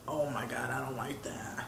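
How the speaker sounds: low, hushed near-whisper of unease; creeped-out aside. Retold or imitated. Man recalls a devil-face poster watching him, creeped-out hush. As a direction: say it softly and low, trailing into a whisper.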